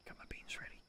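barely audible whisper; hushed whisper. Real, not acted. Player hiding from voices outside, whispering. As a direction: whisper it so nobody outside hears.

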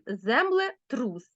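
землетрус said very slowly; slow example word. Shown as a demonstration. Teacher showing pupils a new word, very slowly. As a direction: build землетрус slowly from its parts. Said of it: "Наприклад".

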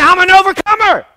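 loud, high proclamation; bold declaration. Shown as a demonstration. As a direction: announce it to the mirror. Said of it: "look in the mirror and say".

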